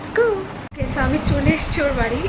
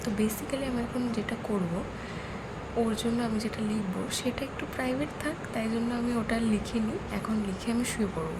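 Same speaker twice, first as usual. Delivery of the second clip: hushed, softer late-night murmur; speaking softly; 7 dB quieter than usual. Real, not acted. Vlogger making a card at 3 a.m., hushed so no one wakes. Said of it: "আমি এত আস্তে কথা বলছি".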